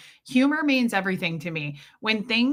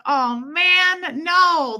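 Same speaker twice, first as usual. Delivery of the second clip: dismayed oh no; 8 semitones higher, 6 dB louder, much slower than usual. Retold or imitated. Dad about to comment on mustache.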